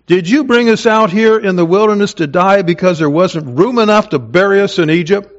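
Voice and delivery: mock the leader with a bitter question; sarcastic. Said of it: "I would sort of paraphrase it this way, that in their sarcasm".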